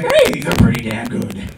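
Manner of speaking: high, loud acted delight; pleasant surprise. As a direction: exclaim it like a surprised first taster. Acted taster's surprised discovery.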